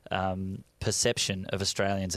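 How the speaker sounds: usual speaking voice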